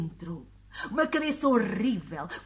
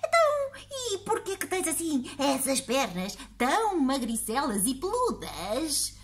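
put-on high, prying old lady voice; nosy old woman; 4 semitones higher than usual. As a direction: pester him like a nosy granny. Old woman keeps asking.

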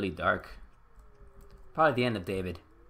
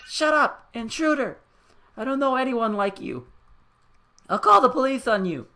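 much higher and louder; mother screaming at her son to get out of her house, enraged; furious scream; 9 semitones higher, 9 dB louder than usual. Acted. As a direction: drive the intruder out.

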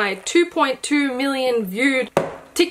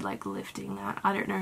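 ordinary delivery

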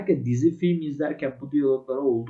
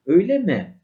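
surprised reply; 8 semitones higher, 9 dB louder than usual. Shown as a demonstration. Acting surprised reply in dialogue.

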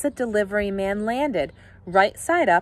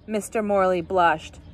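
plain reading voice

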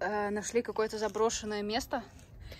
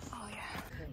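whisper; 11 dB quieter than usual. Real, not acted. Awed arrival at summit.

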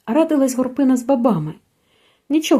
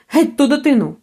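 angry master's shout; 4 semitones higher, 3 dB louder than usual. A narrator's reading. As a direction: bark it out at her. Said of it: "гукнув". (гнівний вигук пана)

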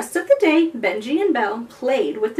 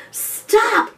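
high, urgent yelp; yell; 9 semitones higher than usual. A narrator's reading. Benji yelling at the dugong to halt. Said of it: "Benji yelled".

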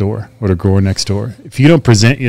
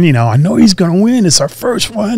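excited insistence; 10 semitones higher than usual. Retold or imitated. Retelling his own eager insistence.

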